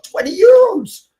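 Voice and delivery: very high, drawn-out squeal of disbelief; incredulous mock shriek; acted astonishment. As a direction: repeat the price in hammed-up shock, loud and stretched. His amazed reaction to seller's price.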